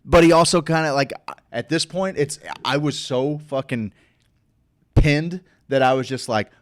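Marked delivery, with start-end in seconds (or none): none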